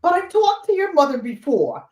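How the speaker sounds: high, puzzled Boston voice; Boston accent. Retold or imitated. Surprised dog buyer protesting on the phone, imitated Boston accent. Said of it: "with her Boston accent".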